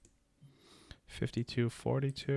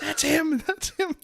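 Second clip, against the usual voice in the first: very high-pitched excited little cry; high, tiny mock-panicked voice; mock alarmed squeal; 4 dB louder, slower than usual. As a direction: squeal it, as if pointing out a stranger. Imagined reaction to a stranger in Publix.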